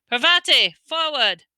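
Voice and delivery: loud, high call; shouted. A narrator's reading. Teacher calling a pupil forward, shouting. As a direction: call the next pupil up sharply. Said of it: "Professor Lupin shouted".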